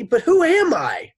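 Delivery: put-on very high, loud kid holler; bewildered shout. A narrator's reading. Girl with a stranger's voice, high loud shout. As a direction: shout the question high and swooping. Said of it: "shouted Jenny".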